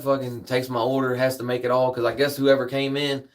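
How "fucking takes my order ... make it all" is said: ordinary delivery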